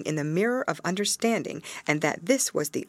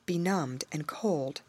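usual speaking voice

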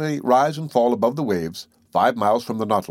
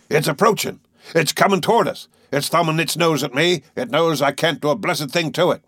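higher-pitched, urgent excitement; full of excitement; 6 semitones higher than usual. A narrator's reading. Harpooner watching the whale approach, excited and frustrated. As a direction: blurt it out, thrilled and frustrated. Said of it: "his voice full of excitement".

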